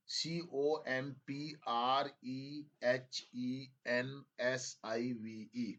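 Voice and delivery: letters spelled out slowly; slow spelling dictation. Shown as a demonstration. Teacher re-spelling comprehensive slowly for students. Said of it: "comprehensive बोला था ना".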